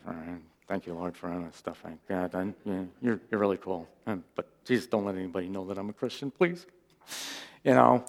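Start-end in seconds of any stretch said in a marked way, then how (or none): 0.0-6.6 very quiet, low, hurried mumbled prayer; hushed, self-conscious parody of a prayer; mock reserved prayer. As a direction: pray under your breath so nobody overhears. Mock timid Presbyterian prayer.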